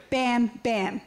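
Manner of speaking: ordinary talk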